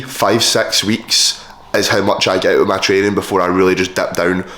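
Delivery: ordinary talk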